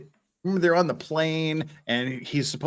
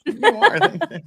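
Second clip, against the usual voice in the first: laughing; 7 dB louder, slower than usual. Real, not acted. Laughing at chat compliment.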